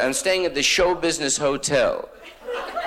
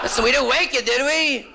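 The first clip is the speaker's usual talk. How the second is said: put-on eager old man on phone. Old man on the phone checking he didn't wake him. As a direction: fuss politely like a nosy old man.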